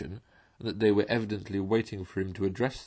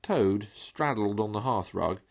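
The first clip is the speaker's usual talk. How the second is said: plain reading voice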